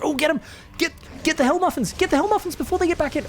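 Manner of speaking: shouting at the screen to chase the units down; excited yelling. Real, not acted. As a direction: yell them on, fast and thrilled. Excited calls during fight.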